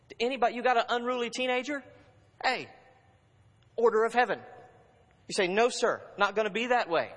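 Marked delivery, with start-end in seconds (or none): 2.4-7.1 put-on louder, firm rebuke of a rebellious teen; firm command. Preacher rebuking an unruly teenager, loud and firm. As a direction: lay down the law, no room for argument.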